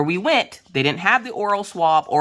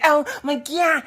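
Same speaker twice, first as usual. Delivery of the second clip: high panicked squeal; acted-out cry of panic; freaking out; 13 semitones higher, slower than usual. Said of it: "I'm freaking out".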